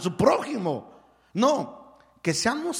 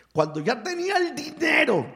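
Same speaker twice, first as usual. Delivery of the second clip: dramatic build; 10 semitones higher, 4 dB louder than usual. Acted. Dramatic climax of story.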